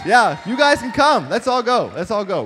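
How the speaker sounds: rally everyone like a cheerleader; mock hype shout. Preacher inviting the church on runs with a loud shout. Hyped joke about going running.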